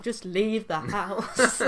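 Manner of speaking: very slow, laughing brush-off; drawn-out laughing order; laughing dismissal. Retold or imitated. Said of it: "and I'm like".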